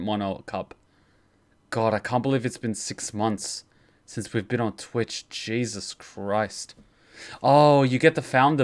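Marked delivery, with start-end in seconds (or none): none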